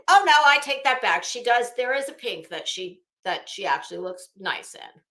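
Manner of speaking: ordinary talk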